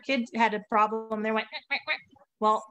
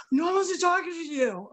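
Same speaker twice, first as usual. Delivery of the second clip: put-on higher, taunting kid's voice; mocking kid taunt; 6 semitones higher than usual. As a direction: throw it back like a bratty kid.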